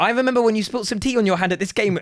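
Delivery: mock earnest young fan reading his letter; kid's letter voice. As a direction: read it out as his thirteen-year-old self. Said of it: "You know, like that".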